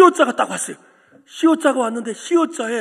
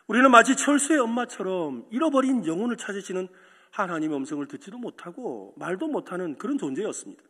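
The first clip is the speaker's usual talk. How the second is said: ordinary talk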